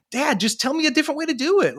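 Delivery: put-on high, quick voice of a kid appealing to dad; pleading kid. Kid appealing to dad for guidance, high-pitched plea. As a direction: lightly imitate a kid asking for guidance. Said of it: "your kid is actually saying".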